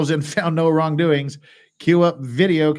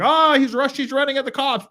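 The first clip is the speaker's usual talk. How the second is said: mock officer shout; 10 semitones higher, 3 dB louder than usual. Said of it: "And like".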